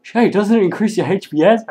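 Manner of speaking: loud, high mocking quote; mock clueless player. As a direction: play a dim player asking loudly.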